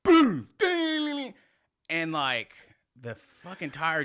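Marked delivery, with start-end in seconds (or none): 0.0-0.5 loud, high boom sound; imitated boom. Retelling how the tire flew off, imitated boom. 0.6-1.3 high, ringing metallic ding; imitated ding. Retelling how the metal thing flew off, imitated ding.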